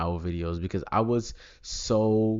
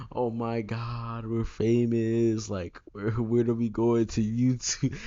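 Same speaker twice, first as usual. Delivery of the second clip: drawn-out, higher-pitched mock excitement; imitated kid freaking out; 4 semitones higher than usual. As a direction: gush like your starstruck younger self. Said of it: "be, like, freaking out".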